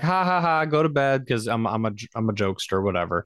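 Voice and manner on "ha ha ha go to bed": mock dismissive laugh. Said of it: "they were like".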